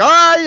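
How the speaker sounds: high loud mock yelp; startled yelp. Scared driver faced by the huge worker, high yelp. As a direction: yelp like a frightened man.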